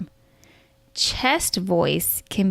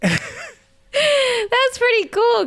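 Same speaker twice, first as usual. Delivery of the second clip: high silly voice; 14 semitones higher, much slower than usual. Pretend. High slow goofy line.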